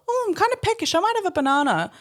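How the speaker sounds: high sing-song fake innocence; a hammed-up innocent voice; mock innocent. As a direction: play it coy and sweet. Mock innocent voice taking banana.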